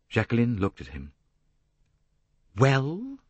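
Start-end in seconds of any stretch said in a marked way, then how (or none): 2.5-3.2 high rising challenge; challenging. A narrator's reading. Jacqueline answering Poirot's rebuke, challenging. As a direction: throw it back as a dare. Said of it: "Her smile was definitely challenging".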